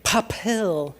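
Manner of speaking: "papel" with a heavy puff of air on the p's; aspirated p. Shown as a demonstration. Teacher shows the wrong, puffed "papel". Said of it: "no está bien tener el papel así".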